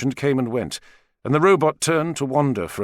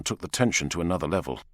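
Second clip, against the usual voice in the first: usual speaking voice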